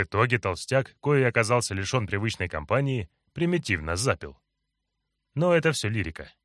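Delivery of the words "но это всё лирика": ordinary delivery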